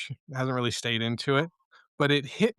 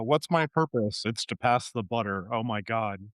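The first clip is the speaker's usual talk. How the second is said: lightly voiced cartoon meme quote; Rick and Morty meme; 3 semitones lower than usual. Podcast guest quoting a meme to co-hosts, lightly acted cartoon voice. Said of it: "That's the Rick and Morty meme".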